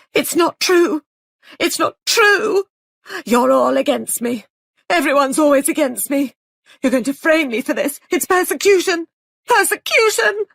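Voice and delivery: high, loud, panicked protest; shrill hysterical outburst. A narrator's reading. Young man shrieks at the accusing room, hysterical. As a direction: scream your innocence, voice cracking high. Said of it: "His voice rose, shrill and hysterical".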